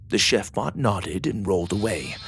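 ordinary delivery, nothing marked

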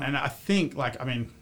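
ordinary delivery

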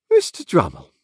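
high-pitched genteel address; lightly imperious lady; haughty hostess. A narrator's reading. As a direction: call to a dinner guest grandly. Lady addresses her dinner guest.

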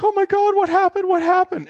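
very high, fretting crowd voice; mock panic. Everyone on set rushes to the fallen star, mock panicked squeal. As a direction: squeal it like alarmed onlookers. Crowd reacts to star's fall.

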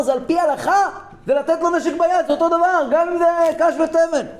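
high, pressing sermon voice; heated preaching. Real, not acted. Rabbi's teacher insisting on the law, heated preaching. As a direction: drive the point home with heat.

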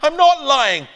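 high, loud protest; cried out. Acted. Ben crying out to the neighbour, high and loud. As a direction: protest desperately. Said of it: "cried Ben".

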